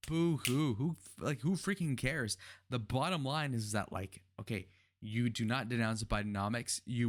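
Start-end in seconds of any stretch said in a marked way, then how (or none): 0.1-0.7 mocking boo hoo. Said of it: "I feel for you guys". 0.7-2.4 exasperated. Real, not acted. Repeated dismissive outburst.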